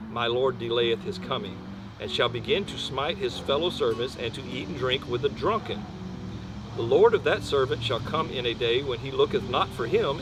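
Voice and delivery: ordinary talk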